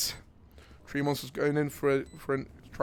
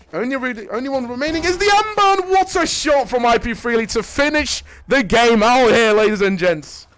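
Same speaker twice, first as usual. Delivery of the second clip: raised, quick commentary building to a loud peak; excited play-by-play; 7 semitones higher, 7 dB louder than usual. Real, not acted. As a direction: ride the action up to the winning shot. Esports play-by-play.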